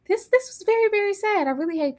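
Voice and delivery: high, strained sadness; high pained lament over the scene. Real, not acted. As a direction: let the voice climb in pain. Grieving over the farewell.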